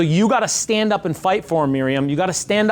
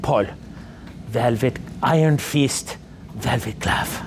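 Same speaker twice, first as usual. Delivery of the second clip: lightly put-on French accent with a German edge; a hint of an Alsatian French accent, slow and deliberate; 7 semitones lower, 3 dB quieter, slower than usual. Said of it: "French accent with, like, this German".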